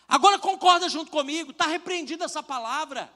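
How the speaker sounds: loud, high preaching at full force; preaching shout. Retold or imitated. Pastor preaching back at the rebuking friend, loud and fiery. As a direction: preach it hard at him. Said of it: "Eu falei pra ele".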